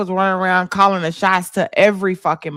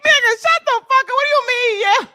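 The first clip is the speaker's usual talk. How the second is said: higher mock outrage from Smitty; imitating Smitty; 13 semitones higher than usual. Voicing Smitty's angry look at her cheering, mock outraged. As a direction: play the friend glaring in disbelief.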